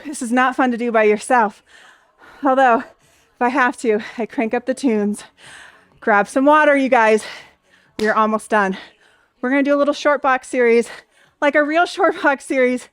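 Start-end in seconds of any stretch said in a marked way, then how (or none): none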